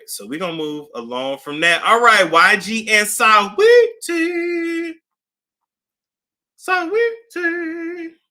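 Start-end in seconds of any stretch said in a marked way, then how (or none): 3.1-8.2 drawn-out name. Shown as a demonstration. Announcing the next gossip topic, drawn out. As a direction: sing the name out slowly. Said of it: "drawn out name".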